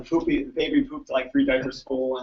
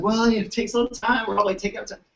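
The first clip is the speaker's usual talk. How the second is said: higher, patient reassuring grandma; 7 semitones higher than usual. Retold or imitated. Grandma reassuring her doubtful daughter, higher. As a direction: soothe her with a lifted voice. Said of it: "Grandma's like".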